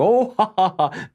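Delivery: hammed-up polite laughing; mock crowd laughter. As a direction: fake a fawning audience laugh. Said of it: "They're all laughing".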